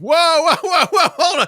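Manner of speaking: high, loud comic protest; mock alarm. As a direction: yell to halt it, hammed-up shock. Acted alarm at Batman killing.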